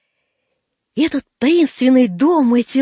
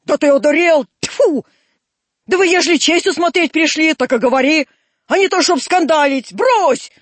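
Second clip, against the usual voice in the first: loud, raised scolding; indignant rebuke; 5 semitones higher, 4 dB louder than usual. Acted. Old man rebukes Драп for throwing dung, indignant rebuke. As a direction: tell off an unruly boy indignantly.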